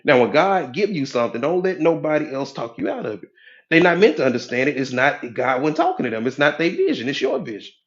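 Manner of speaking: ordinary delivery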